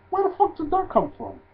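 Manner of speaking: very high incredulous question; exasperated disbelief. Real, not acted. Fan complaining about a storyline from nowhere, high exasperated disbelief. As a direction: demand an explanation, pitch way up.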